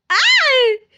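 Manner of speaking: loud, high yelp of pain; startled cry. Pretend. Vlogger yelps when Limon bites her ear, loud high cry. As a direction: yelp as if the ear was just bitten.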